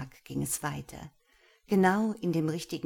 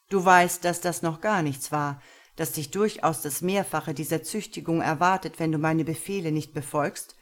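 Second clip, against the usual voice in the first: dominant master; 5 dB louder than usual. Acted. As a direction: speak as a pleased, cold master. Master threatens Lena. (Herr spricht zufrieden und dominant zu Lena)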